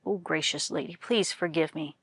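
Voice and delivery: the scholar begs his wife for forgiveness, softly; humble plea. A narrator's reading. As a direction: beg her meekly under your breath. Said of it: "humbly pleaded".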